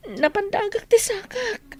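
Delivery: crying in pain. Acted. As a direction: sob the words through the pain. Hurt foot, crying. (agsangsangit a mangibaga iti sakitna)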